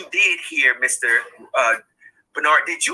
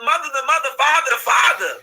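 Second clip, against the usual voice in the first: ordinary talk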